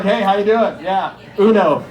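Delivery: loud, cocky flirt with laughs; hammed-up pickup guy. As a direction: play the smooth talker badly. Imagined pickup line.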